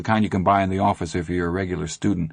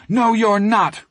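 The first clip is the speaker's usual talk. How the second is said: high, loud snapped refusal; angry defiance; 13 semitones higher, 5 dB louder, slower than usual. Acted. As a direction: shout them down. Boy defies older bullies.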